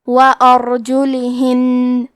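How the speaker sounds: وارجلہن with a thick, heavy ر, slowly. Shown as a demonstration. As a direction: recite وارجلہن with a full heavy ر. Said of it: "حرف را کو موٹی آواز میں پڑھنا ہے".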